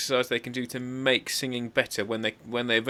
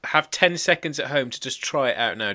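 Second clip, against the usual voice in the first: ordinary talk, nothing marked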